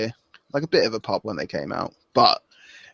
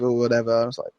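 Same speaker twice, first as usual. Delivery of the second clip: ordinary talk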